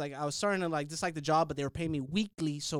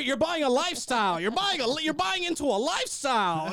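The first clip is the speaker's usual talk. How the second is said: higher, louder hammed-up salesman patter; salesman pitch; 8 semitones higher, 4 dB louder than usual. Car salesman pitching a family, hammed-up and loud. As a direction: oversell the lifestyle like a car salesman.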